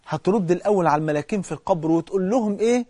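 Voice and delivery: ordinary talk, nothing marked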